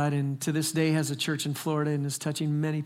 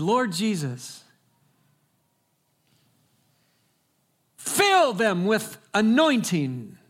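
slow, louder, higher prophetic cry over the couple; impassioned slow preacher's prayer, raised and loud; fervent preacher praying; 5 semitones higher, 5 dB louder, much slower than usual. Retold or imitated. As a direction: stretch each word out like a revival preacher calling down power. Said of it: "he said".

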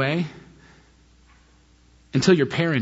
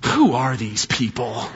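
indignant thought; 6 semitones lower, slower than usual. Retold or imitated. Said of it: "In my mind, I'm thinking".

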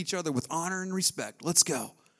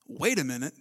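normal talking voice

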